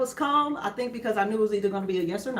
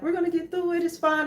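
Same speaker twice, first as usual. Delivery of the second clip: higher, gentle reassuring voice; calm reassurance; 9 semitones higher than usual. Retold or imitated. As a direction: soothe the family, it's all fine. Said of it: "very, very calm about it".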